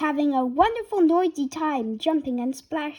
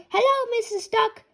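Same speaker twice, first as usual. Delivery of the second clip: put-on much higher little girl call; little pig calling to a duck; piggy cry; 10 semitones higher than usual. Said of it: "cries Peppa".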